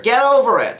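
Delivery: high-pitched, punchy mock scolding; imitating mother. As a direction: belt it out as mom's blunt catchphrase. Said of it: "that was what I needed to hear".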